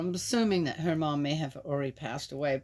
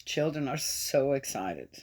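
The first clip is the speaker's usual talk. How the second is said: usual speaking voice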